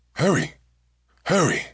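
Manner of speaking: urgent high, loud call. A narrator's reading. Hijack leader calling out to his accomplice, urgent shout. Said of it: "Harsha called out to Salam".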